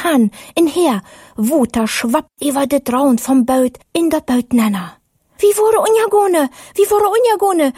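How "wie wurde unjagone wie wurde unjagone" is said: high panicked calling out; a fisherman crying out in fear; frightened cry. Acted. Disciple in the storm cries out in high panic. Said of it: "schrie eine von den Männer".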